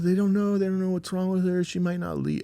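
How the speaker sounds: high, urgent retelling of a distraught caller; hysterical distress. Retold or imitated. Sarah breaks the news by phone, imitated distress. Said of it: "crying hysterically".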